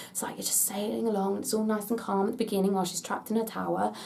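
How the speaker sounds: softly setting a peaceful scene; calm and hushed. Acted. Vlogger pitching a ride's calm beginning, hushed. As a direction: drop the voice, gently sailing along. Acting out calm start of ride.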